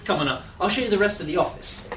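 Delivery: ordinary talk, nothing marked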